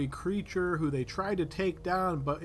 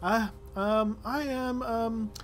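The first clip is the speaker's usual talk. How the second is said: higher-pitched, doubtful villager voice; hesitant older woman; 6 semitones higher, 3 dB louder than usual. Acted. Older midwife introduces herself to adventurers, hesitant. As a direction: play an older midwife, politely unsure, with halting pauses. Game master plays the old woman.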